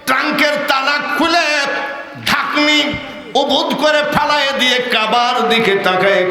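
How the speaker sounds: high-pitched declaiming in short bursts; full-force sermon narration; impassioned preaching. Acted. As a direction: cry the story out to the crowd, high and forceful.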